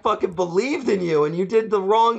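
wounded Santa, played for laughs; hurt Santa. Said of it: "It's like".

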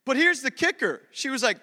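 usual speaking voice

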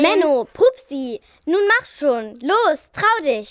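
lauter, drängend ungeduldig. Acted. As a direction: treibt die Freundin genervt an. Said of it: "sagte Pepsi etwas ungeduldig". (Pepsi drängt Pupsi ungeduldig über die Brücke)

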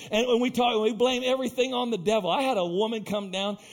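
ordinary talk, nothing marked

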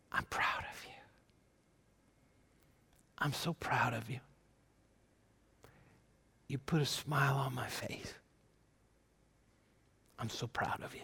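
very soft, low whisper with long pauses; encouraging whisper. Acted. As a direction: whisper it gently, as God encouraging someone. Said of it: "He's whispering to you".

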